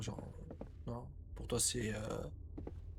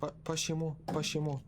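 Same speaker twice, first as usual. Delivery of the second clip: playful high refusal; 5 semitones higher than usual. Real, not acted. Refuses the ghost in the game.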